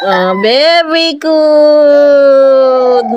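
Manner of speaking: long, very slow praise; drawn-out praise. Real, not acted. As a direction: stretch the last word right out in praise. Praising the child's attempt. (mabagal at mahabang papuri)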